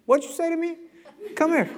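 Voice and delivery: stern mom. Retold or imitated. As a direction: demand answer like an offended mother. Voicing mom.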